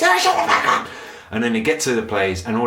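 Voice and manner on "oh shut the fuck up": put-on very high, loud screech at the kids; mock screaming parent. British parent swearing at their kids, shrill mock scream. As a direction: shriek it like a frazzled mum. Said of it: "swearing at their kids".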